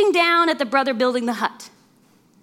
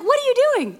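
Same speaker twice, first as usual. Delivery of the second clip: alarmed cry; 10 semitones higher than usual. Acted. Terrified brothers confront.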